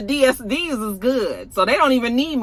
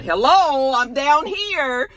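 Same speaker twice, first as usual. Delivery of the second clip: calling for attention; 6 semitones higher, much slower than usual. Retold or imitated. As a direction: call out impatiently to someone overlooking you.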